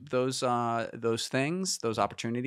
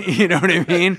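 laughing; 9 semitones higher, 13 dB louder than usual. Real, not acted. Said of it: "That's your, like, old-timey voice".

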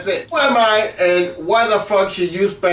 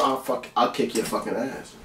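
quiet mock threat; 9 semitones lower, 11 dB quieter than usual. Quiet playful threat to viewers.

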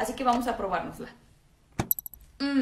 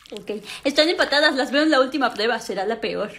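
ordinary talk, nothing marked

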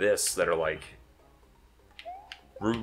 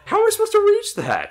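high, loud disbelieving cry; incredulous; 9 dB louder than usual. Real, not acted. Player stuck on a hard level, shouting in disbelief.

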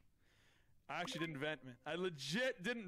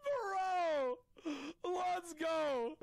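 high, loud celebratory shout; hype shout; 10 semitones higher, 3 dB louder, much slower than usual. Real, not acted. Streamer cheering his win, high loud shout. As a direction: cheer like you just won.

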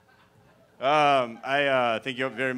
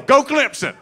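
much higher, loud yell; yelled cheer; 12 semitones higher, 8 dB louder than usual. Retold or imitated. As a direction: bellow the team cheer. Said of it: "and they yell".

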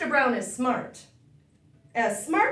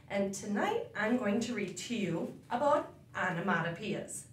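ordinary delivery

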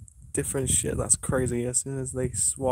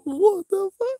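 shocked yell; 8 dB louder than usual. Real, not acted. Reacting to the spirit box.

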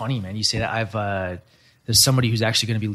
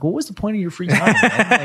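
higher, incredulous mock question; 8 semitones higher, 4 dB louder than usual. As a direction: exaggerate the disbelief. Imagined retort to Google leavers.

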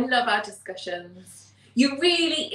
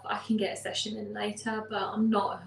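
ordinary delivery

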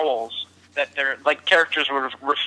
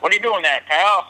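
Mo Howard; 3 semitones higher than usual. Retold or imitated. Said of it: "sounding like a Mo Howard impression".

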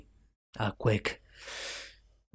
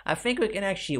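voice crack; 9 semitones higher, faster than usual. Real, not acted. Gamer notes his own voice cracking. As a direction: pitch cracks up high mid-sentence. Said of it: "with the voice crack, did you hear that".

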